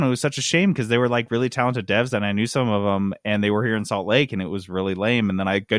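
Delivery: normal talking voice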